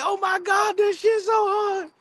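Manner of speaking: squeaky high mock hype. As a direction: gush like he's blown away by the verse. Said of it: "[name] was like".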